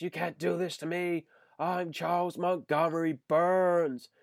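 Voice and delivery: a hint of a wounded tycoon protesting; imitating Burns. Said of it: "the, uh, Citizen Kane reference".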